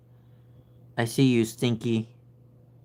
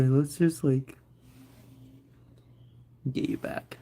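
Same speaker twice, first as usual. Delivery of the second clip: ordinary talk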